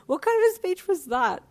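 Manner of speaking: high loud disbelief; high incredulous laugh. A narrator's reading.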